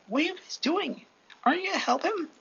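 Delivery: very high-pitched incredulous demand; acted indignant questioning. Deku to heroes doing nothing, acted indignant demand.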